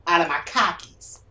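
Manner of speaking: mock Texas accent. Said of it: "the R's that Boston lost".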